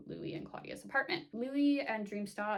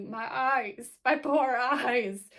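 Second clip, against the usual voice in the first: slow, loud wail, hammed-up over traumatised eyes; mock anguish; 5 semitones higher, 11 dB louder, much slower than usual. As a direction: groan it out slowly, hamming up the pain of what was seen. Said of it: "my friend kept texting me".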